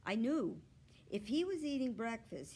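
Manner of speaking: ordinary delivery, nothing marked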